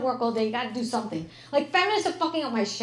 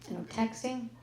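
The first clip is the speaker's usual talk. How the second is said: ordinary talk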